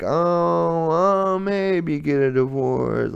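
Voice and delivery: high, slow, put-on dithering voice; mock wavering. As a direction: hem and haw like you can't decide.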